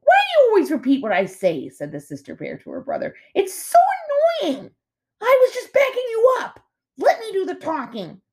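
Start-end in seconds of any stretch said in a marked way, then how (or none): 0.0-1.7 irritated sister bear. A narrator's reading. Bickering bear siblings. 3.4-4.7 exasperated sister bear. A narrator's reading. Bickering bear siblings. 5.2-6.6 whining brother bear. A narrator's reading. Bickering bear siblings. 7.0-8.2 bossy sister bear. A narrator's reading. Bickering bear siblings.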